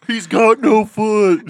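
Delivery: mock old man. Friend mocks the one-footed old driver, slow and loud. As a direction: drag it out mocking the old man.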